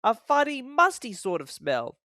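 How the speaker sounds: high, cheeky little engine sniff; scornful little engine; Thomas remarks. A narrator's reading. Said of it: "said Thomas".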